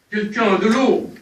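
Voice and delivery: shouted cry for water, high and loud; calling for help. Acted. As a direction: bellow for someone to bring water. He panics, calls for water. (appel affolé, crié fort)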